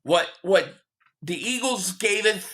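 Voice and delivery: ordinary talk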